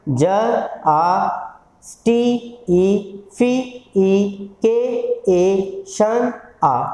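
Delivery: each syllable then its vowel, slow: "ज, आ, स्टी, ई"; sound-by-sound breakdown. Shown as a demonstration. Teacher pulling vowels from justification, sound-by-sound. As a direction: isolate and hold "स्टी", "फी", "के", "शन" with their vowels. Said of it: "कितनी sound आई इसके अंदर".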